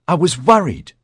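high, loud worried male voice; anxious whine. Acted. Dudley whining his worry.

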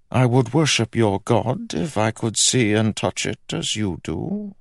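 high-pitched royal voice; haughty king. A narrator's reading. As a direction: speak as a scornful monarch, pitched high.

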